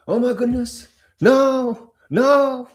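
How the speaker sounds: high, loud hammed-up panic; panicked trader, hammed up and loud; mock panic. Mock panic over sell-off.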